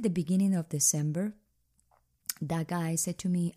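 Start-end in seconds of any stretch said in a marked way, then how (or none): none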